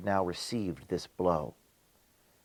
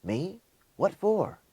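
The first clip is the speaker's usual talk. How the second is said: high, startled mock gasp of a simpleton; a lightly voiced simpleton, suddenly frightened; gasping bewilderment; 10 semitones higher, slower than usual. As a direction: gasp it out, caught off guard. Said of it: "can gasp out only".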